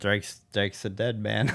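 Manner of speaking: usual speaking voice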